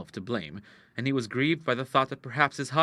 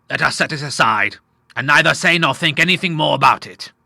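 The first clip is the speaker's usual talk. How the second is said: loud, higher declaration; emphatic resolution; 4 semitones higher, 10 dB louder than usual. A narrator's reading. Father makes peace with daughters, loudly decisive. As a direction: settle it firmly, voice well raised.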